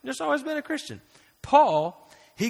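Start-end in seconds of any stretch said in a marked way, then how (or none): 0.0-1.0 mocking naive voice. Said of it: "If you're just like".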